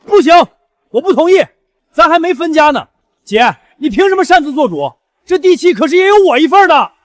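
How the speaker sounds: high, loud indignant protest; angry objection. Acted. Sibling to sister giving away land deed, angry objection.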